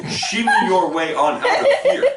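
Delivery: laughing, emphatic eviction; pointed demand. Shown as a demonstration. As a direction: point at the door and say it firmly. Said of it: "I point at the door and say".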